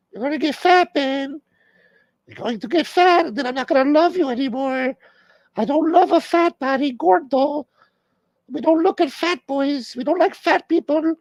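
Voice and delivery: hammed-up girlfriend nagging in falsetto; wife's voice. Comic voices [name] nagging [name], high mock girlfriend voice.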